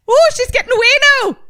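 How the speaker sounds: high, fast leprechaun squeal. Shown as a demonstration. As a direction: rattle it off like a tiny leprechaun. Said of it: "a little leprechaun".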